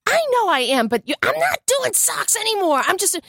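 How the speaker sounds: high defiant retort. Retold or imitated. Mom firing back at husband, high and defiant. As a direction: insist you're done with socks. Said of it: "And I go".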